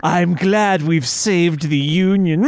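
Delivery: slow, sinister good guy; George McCready. Acted. Villain-voiced ally glad of victory, slow sinister impression. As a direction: gloat slowly like a villain. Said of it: "he's so sinister and yet".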